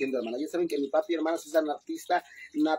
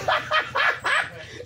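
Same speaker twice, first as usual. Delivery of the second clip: high squealing laugh. Real, not acted.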